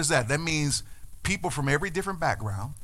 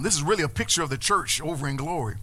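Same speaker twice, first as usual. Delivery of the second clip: usual speaking voice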